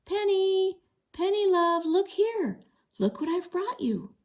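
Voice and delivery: put-on high, sweet girlish call to a horse; lightly voiced girl coaxing her pony. A narrator's reading.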